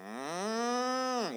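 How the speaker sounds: high humming moo; imitating a cow's moo. Shown as a demonstration. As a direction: hum a moo like a cow. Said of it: "And then I would go".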